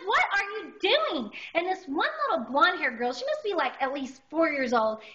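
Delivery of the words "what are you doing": high, drawn-out "what are you doing"; mock indignation, retold; indignant demand. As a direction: demand it in drawling, high-pitched outrage. Acted confrontation with the little girls.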